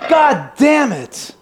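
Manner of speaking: high, slow, drawn-out curse; frustrated curse. Acted. Brother cursing slowly at the dead phone line.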